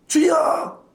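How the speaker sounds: high pleading cry; desperate cry to the Lord. Retold or imitated. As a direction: cry out for mercy. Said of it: "큰 군대처럼 외쳤다는 거예요".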